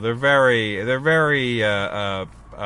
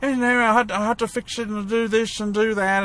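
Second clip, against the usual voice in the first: British impression; 6 semitones higher than usual. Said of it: "the other guy, Richard ... like".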